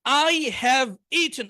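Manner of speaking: I have eaten very slow, word by word; slow drill. Shown as a demonstration. Teacher leading students in choral repetition. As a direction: stretch each word for the class to repeat. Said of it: "সবাই শুরু করেন".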